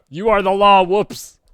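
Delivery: loud, high hammed-up yell; mock shout. Reader hams up the sheriff's missed shot, shouting. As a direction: belt it out, then a yelped "whoops".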